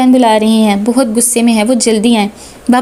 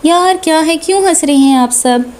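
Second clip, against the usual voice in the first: ordinary delivery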